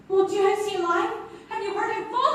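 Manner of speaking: alarmed cry. Acted. Frightened for Ellis.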